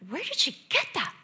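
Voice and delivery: high-pitched mock bewilderment; squeaked, incredulous question; astonished. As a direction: act out a baffled parent. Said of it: "And I'm like".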